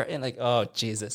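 ordinary delivery

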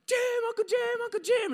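very high, loud calls of his name; shouting for uncle across the boat; frantic yelling. Retold or imitated. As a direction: scream for him to come. Calling out from locked hatch.

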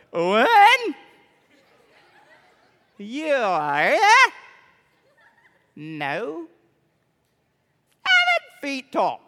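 odd swooping tones, ending high and loud; different tones. Shown as a demonstration. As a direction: play with the pitch, stretching the words. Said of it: "say it with different tones this time".

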